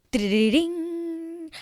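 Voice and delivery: high, ringing "ding" held out; trilled mock bell ring; imitated chime.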